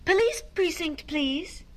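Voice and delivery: high, dainty telephone request; sing-song storybook politeness; very polite. A narrator's reading. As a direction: ask the operator ever so politely. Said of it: "said to the operator very politely".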